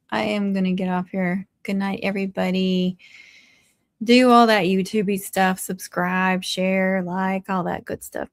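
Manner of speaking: ordinary talk, nothing marked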